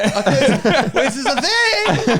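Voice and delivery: hammed-up confused copycat; mock clueless punter. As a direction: audience member joining in, mock baffled. Copying audience member.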